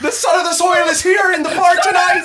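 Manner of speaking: very high, shouted mock announcement; Irish bartender. Bartender announcing the customer to the pub, mock shout. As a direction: bellow it to the whole pub, hammed up. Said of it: "he's the bartender".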